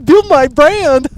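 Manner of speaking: mock the TikTok clout chaser; hammed-up influencer parody; mocking clip farmer. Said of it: "be like".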